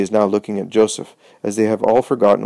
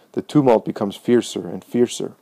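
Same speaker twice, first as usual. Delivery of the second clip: ordinary delivery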